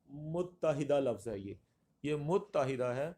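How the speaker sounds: متحدہ slowly as mut-ta-hida; correct pronunciation. Shown as a demonstration. Teacher gives the right word, slow careful pronunciation. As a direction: hold the double t in متحدہ. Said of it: "متحدہ نہیں ہے".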